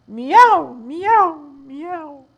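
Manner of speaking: high meows, loud then dying away; mew, trailing off sadly; kitten meows fading. Acted. Kittens crying meow in poem.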